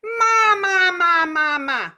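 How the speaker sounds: very high, loud nasal ma, sliding down; nasal calling. Shown as a demonstration. Teacher showing the nasal placement drill, loud and nasal. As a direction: belt it out through the nose. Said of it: "Do that".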